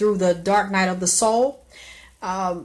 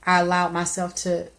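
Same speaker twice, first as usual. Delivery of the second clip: ordinary delivery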